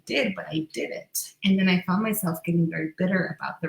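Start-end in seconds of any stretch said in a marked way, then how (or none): 0.3-1.3 unvoiced confession; whispered admission. Real, not acted. Coach admitting her slip, in a whisper. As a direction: whisper it like a guilty secret.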